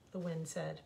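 usual speaking voice

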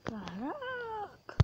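long drawn-out, soft amazed caraca; slow, breathy wonder; astonished gasp. Real, not acted. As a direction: let it stretch in awe. Gasp at something in game.

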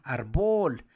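"árbol" said as ar-BOL, stress on bol; wrongly stressed word. Shown as a demonstration. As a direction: put the weight of árbol on the end. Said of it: "la fuerza estaría acá".

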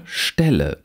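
"Stelle" with a short open e; short lax vowel. Shown as a demonstration. Lecturer reads minimal pairs, short lax vowel. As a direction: keep the e in "Stelle" brief.

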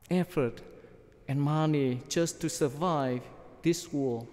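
usual speaking voice

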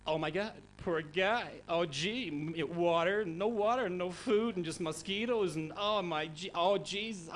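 a light mock American accent, gushing. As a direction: gush like a lightly done American tourist. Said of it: "the Americans are probably saying".

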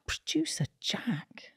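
scandalised whisper; gasping whisper. Acted. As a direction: gasp then whisper the name. Gossip gasp about producer.

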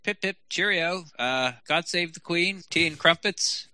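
hammed-up fake Aussie voice, really sounding English; bad Australian accent. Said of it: "Can I try my Australian accent?".

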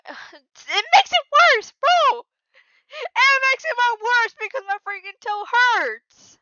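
loud, high, drawn-out whine; high tearful complaint, very slow; pained wailing. Real, not acted. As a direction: wail it out as if crying. Complaining about a hurt toe.

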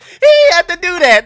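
real laughing exclamation; laughing disbelief. Real, not acted. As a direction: laugh out the words in delight. Laughing at the juke.

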